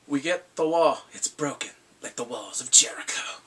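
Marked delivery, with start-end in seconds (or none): 2.0-3.4 dramatic whisper. Pretend.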